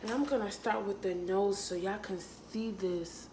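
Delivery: ordinary talk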